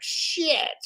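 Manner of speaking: a sudden high yelp of "shit"; mock panic at the news; mock alarm. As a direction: blurt it out in a startled, high pitch. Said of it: "and they're like".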